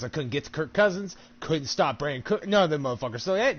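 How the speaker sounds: normal talking voice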